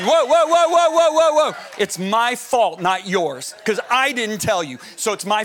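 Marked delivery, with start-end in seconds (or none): 0.0-1.6 loud, high, rapid-fire whoas; calling a halt. Real, not acted. Preacher stopping someone who moved too soon, calling halt. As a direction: stop them with repeated raised whoas.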